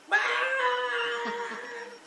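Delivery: shrieking laugh, way up high; high burst of laughter. Real, not acted. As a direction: let out a high squealing laugh. Laughing at his own story.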